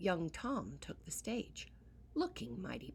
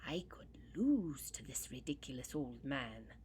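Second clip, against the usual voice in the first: whisper; 4 semitones lower than usual. Acted. Spiteful aside in a story.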